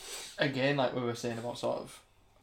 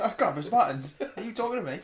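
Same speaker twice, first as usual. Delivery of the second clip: Cockney voice rising much higher and louder; hammed-up Cockney impression; mock Cockney; 7 semitones higher, 6 dB louder than usual. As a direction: camp it up in a Cockney accent. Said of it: "do it in a Cockney accent".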